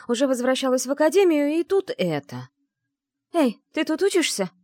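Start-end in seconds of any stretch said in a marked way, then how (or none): 3.3-4.5 calling out. Acted. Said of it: "позвала меня какая-то женщина".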